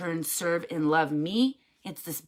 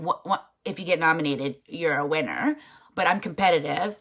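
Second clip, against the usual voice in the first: ordinary delivery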